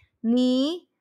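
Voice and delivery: slow, syllable by syllable 你看得出来她几岁吗; teacher modelling each syllable of 你看得出来她几岁吗 slowly; slow teaching demo. Shown as a demonstration. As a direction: spell it out slowly for a learner. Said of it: "You can say".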